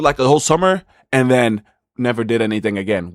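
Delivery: usual speaking voice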